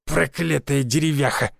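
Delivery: slow, drawn-out angry curse; growled curse. A narrator's reading. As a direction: spit out the curse slowly, with weight. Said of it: "рявкнул я". (раздражённый рявк)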